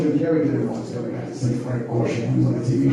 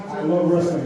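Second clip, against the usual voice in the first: ordinary talk, nothing marked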